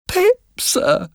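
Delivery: put-on tiny, very high child's voice; frightened boy. A narrator's reading. Frightened boy answers the convict.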